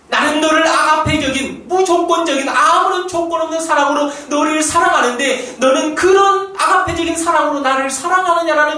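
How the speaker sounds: high, loud preaching cry; impassioned preaching. Acted. Pastor voicing the Lord's question, full-force preaching. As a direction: lift the question to the back row.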